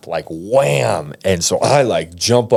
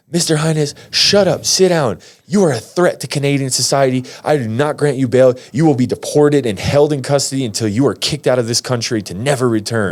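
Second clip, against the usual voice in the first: higher-pitched, emphatic courtroom rebuke; imitated stern judge; 5 semitones higher than usual. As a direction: lay down the sentence like a fed-up judge. Judge rebukes him and denies bail.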